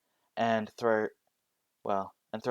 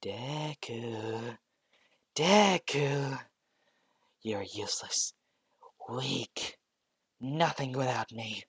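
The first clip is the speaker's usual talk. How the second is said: haunting voice; 3 semitones higher, much slower than usual. Acted. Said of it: "he started hearing a voice".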